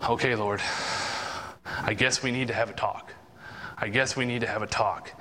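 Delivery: low-pitched, reluctant surrender; resigned. Acted. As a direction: give in humbly, with long pauses. Convicted, gives in to the Lord.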